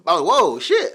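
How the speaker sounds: loud, high burst of mock astonishment; amazed reaction. Host acting out hearing her rap, astonished. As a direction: react wowed, voice leaping way up.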